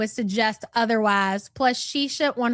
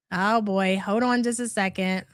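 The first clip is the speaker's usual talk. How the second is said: normal talking voice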